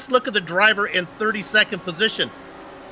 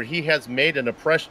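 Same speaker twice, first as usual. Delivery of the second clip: ordinary delivery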